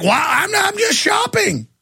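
put-on high, slow, shouted old-lady voice; shrieking old woman. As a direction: screech it like a frightened old lady. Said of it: "The old women are like".

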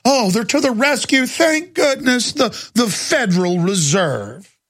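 mock-grateful, high and slow; sarcastic relief. Sneering at the Fed, mock-thankful and slow. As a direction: gush in fake gratitude.

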